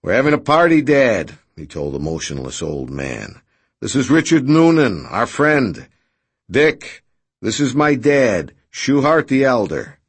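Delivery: loud. A narrator's reading. Redrick loudly introducing to father.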